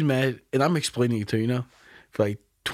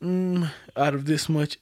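ordinary talk

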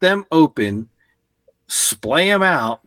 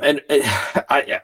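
ordinary talk, nothing marked

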